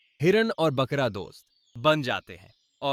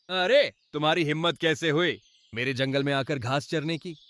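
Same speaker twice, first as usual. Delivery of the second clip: usual speaking voice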